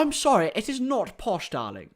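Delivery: hammed-up posh English, high then dropping; mock posh accent. Streamer mocks posh accusation, mock posh English accent. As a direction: put on a posh accent, swooping down at the end. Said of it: "This is posh".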